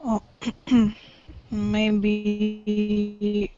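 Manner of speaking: ordinary delivery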